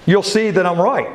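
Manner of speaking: usual speaking voice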